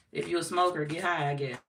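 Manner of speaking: usual speaking voice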